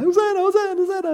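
put-on high-pitched excited kids' chant; little kids. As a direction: chant like kids running around. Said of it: "our little kids running around".

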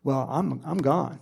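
ordinary talk, nothing marked

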